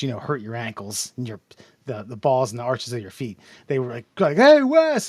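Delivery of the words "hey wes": high, loud call across the rink; shouting a friend's name. Retold or imitated. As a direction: call his name from the side. People calling out at rink.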